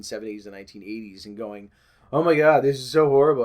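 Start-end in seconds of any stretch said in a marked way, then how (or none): 2.1-3.4 loud hammed-up alarm; mock panic. Vlogger parodying the alarmist crowd with a loud, hammed-up panic. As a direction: overplay the panic, loudly.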